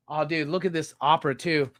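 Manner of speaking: ordinary talk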